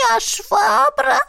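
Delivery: high, slow indignant squeak; can't let it go, squeaky; indignant otter voice. A narrator's reading. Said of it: "не унималась Ларинь".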